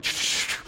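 soft unvoiced shh of a ball through leaves; whispered whoosh. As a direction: breathe out the rustle of leaves. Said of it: "I hear it going".

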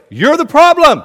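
loud, high-pitched blame; accusing shout. Acted. Acts Ahab blaming the prophet.

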